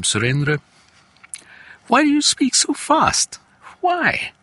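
imitating a father, pitch leaping very high; high-pitched mock parental questioning; exasperated father. Father asking his son about fast speech, exasperated.

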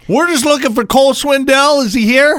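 high, pleading mock voice; panicked intruder. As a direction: protest innocence as a duped fan. Voicing the scammed intruders to police.

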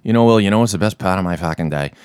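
put-on a real Boston accent in a low, easy movie-scene voice; natural Boston accent. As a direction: deliver it like a Southie friend speaking from the heart. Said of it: "who just nail it".

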